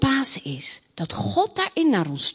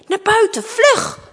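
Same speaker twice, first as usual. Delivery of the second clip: high, loud escape order; urgent command; 9 semitones higher, 6 dB louder than usual. Acted. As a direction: hurry the child out at once. King hurries daughter outside. (dringend naar buiten sturen)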